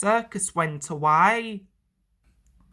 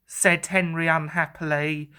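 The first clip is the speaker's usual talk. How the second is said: usual speaking voice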